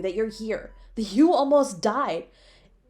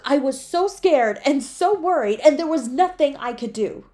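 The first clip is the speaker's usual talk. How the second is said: louder, higher, anguished pleading; raised, shaken voice at the bedside; distraught outburst; 6 semitones higher, 6 dB louder than usual. Acted. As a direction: let the fear pour out. Distraught over partner nearly dying.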